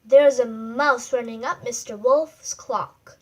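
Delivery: usual speaking voice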